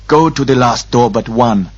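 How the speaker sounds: harsh priest giving orders; forceful temple guard instruction; Grolem priest. A narrator's reading. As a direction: direct them in a stern priestly voice. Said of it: "the Grolem instructed".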